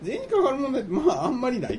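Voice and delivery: laughing. Real, not acted. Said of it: "あんまりないと思いますよ".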